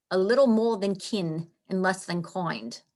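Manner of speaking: "coined" with an oy vowel, for kind; old-style vowel turning kind into "coined"; original pronunciation. Shown as a demonstration. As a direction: say "coined" like coin plus d. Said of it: "would have said it back then".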